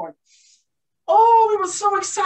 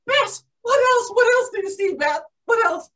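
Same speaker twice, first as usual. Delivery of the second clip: eager little sister; 7 semitones higher than usual. Acted. Sisters eagerly asking for more.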